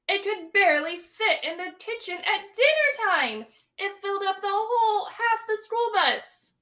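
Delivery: put-on lively read-aloud for young children; storybook reading. A narrator's reading. Teacher reading a picture book to her class, lively storytelling. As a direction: read it big and bright to the class.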